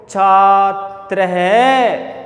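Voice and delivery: छात्र है drawn out, visarga breathed as है; slow visarga reading. Shown as a demonstration. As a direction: model it slowly. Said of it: "बोलो".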